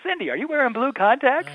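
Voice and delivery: high, sing-out group question; imitating a crowd's teasing chorus; crowd in unison. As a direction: call it out like a whole bar at once. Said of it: "So in unison, thirty people".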